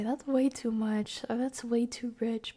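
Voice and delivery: usual speaking voice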